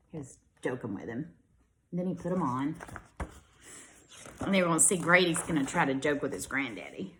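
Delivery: plain reading voice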